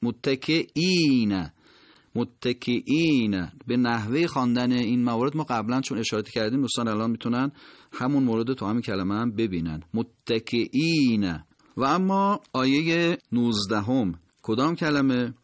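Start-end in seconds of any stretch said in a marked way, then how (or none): 0.0-3.5 متکئین with drawn-out "īī" after hamza; elongated vowel after hamza. Shown as a demonstration. 9.9-11.4 متکئین slowly, long "īī" after hamza; elongated vowel after hamza. Shown as a demonstration.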